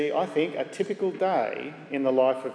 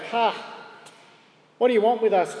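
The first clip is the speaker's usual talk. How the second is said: higher, louder cry of the demon; 6 semitones higher, 3 dB louder, faster than usual. Acted. Demon in the synagogue confronting Jesus, loud high cry. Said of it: "the demon cries out".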